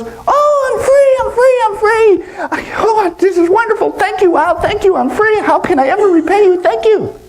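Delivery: squealing high monkey voice; overjoyed monkey. Acted. Freed monkey thanking the owl, ecstatic squeal. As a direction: gush with relief in a very high monkey voice.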